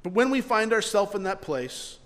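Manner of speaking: ordinary delivery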